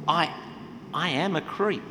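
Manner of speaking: normal talking voice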